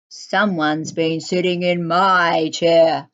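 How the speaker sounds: low, gruff bear voice; Father Bear, loud gruff voice. A narrator's reading. Father Bear finds someone has eaten his porridge, loud and gruff. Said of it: "said Father Bear in a loud".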